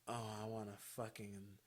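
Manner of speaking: low, hushed come-on; imitating his dirty talk. Caller going all in on her, imitated low and hushed. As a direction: murmur it low and soft, as him. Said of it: "he was just going all in for it".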